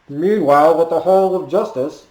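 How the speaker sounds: hammed-up retro cartoon announcer "Meanwhile"; Super Friends narrator. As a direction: boom it like the old Saturday morning narrator. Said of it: "from the old Super Friends show".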